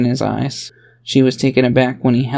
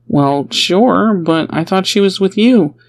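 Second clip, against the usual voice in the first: deflecting; 6 semitones higher, 3 dB louder than usual. A narrator's reading. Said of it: "he tried to deflect".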